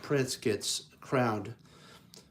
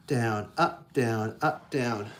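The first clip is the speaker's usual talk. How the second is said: ordinary delivery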